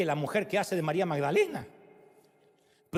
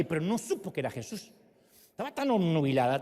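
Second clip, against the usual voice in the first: normal talking voice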